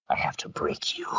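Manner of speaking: hushed villain threat; soft, voiceless cult-leader menace; menacing whisper. Acted. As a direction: drop to a sinister whisper. Villain monologue drops to menace.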